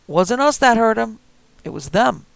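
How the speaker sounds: high, loud, rough threat; old man with shotgun warning the kid, loud; armed old man threatening. Acted. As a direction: bark it through the door. Marty shouting through door.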